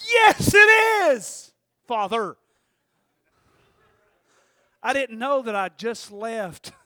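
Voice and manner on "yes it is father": high, drawn-out, overly sweet reply; sly mock formality. Guilty teen answering his father in a sugary, drawn-out voice. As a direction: lay it on thick like a guilty kid.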